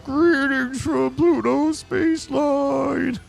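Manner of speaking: very high, slow booming clown greeting; over-the-top clown shout. Acted. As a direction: belt it out like a circus barker. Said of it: "was that too much?".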